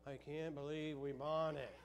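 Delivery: slow, stunned buyer's regret; mock dazed regret; dazed disbelief. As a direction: drag it out as if in shock.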